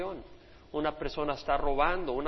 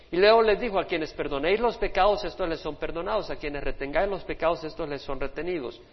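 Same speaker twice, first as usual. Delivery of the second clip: preaching; 4 dB louder than usual. Acted. Preaching at full force, raised voice.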